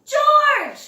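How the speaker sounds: high, loud call across the station. A narrator's reading. Man with the yellow hat calls George from across the station, loud. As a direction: call his name from far off. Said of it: "a familiar voice called".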